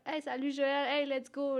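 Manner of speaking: calling out. Retold or imitated. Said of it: "comme on est en train de courir".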